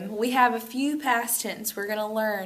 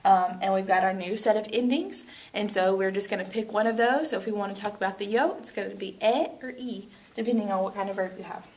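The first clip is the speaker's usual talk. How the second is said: normal talking voice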